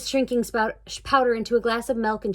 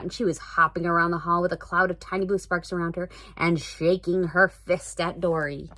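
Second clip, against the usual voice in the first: ordinary delivery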